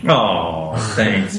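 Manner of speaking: usual speaking voice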